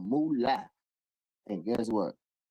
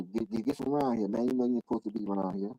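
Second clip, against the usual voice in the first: ordinary talk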